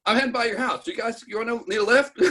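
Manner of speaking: high-pitched imitation of an offer; friendly cop. Squad car cop offering kids a lift, high friendly imitation. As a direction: offer a ride in a high, casual mock voice.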